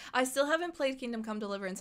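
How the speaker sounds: ordinary talk, nothing marked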